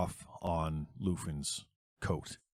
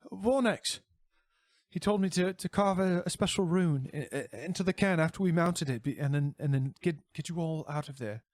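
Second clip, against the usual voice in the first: high, stammering; frightened confession; 11 semitones higher, 4 dB louder than usual. Acted. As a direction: blurt it out in terror. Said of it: "Lufin responds".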